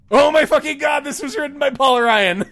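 high, loud astonished shout; shrieking jackpot winner. Real, not acted. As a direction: yell it in stunned disbelief. Incredulous at Paul Ryan author.

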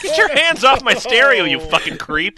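high, loud furious yell; outraged yell. Acted. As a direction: yell at a creep to back off.

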